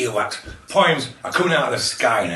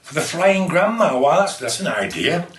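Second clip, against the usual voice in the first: ordinary talk, nothing marked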